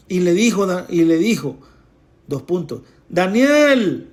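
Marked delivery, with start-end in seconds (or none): 3.1-4.1 higher, louder call of a name. Acted. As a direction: call Daniel across the pit. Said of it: "le grita". (llamado fuerte y agudo)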